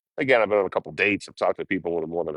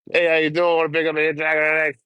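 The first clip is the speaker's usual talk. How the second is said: higher, upbeat sales voice to drivers; hitchhiker pitch; 9 semitones higher, 4 dB louder than usual. Acted. Hitchhiker pitching himself to passing trucks, high and chirpy. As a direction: hawk a ride like a street vendor.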